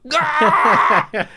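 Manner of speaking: high, loud mock scream; retold pain scream. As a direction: yell out as if a bone is wrenched. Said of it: "I go".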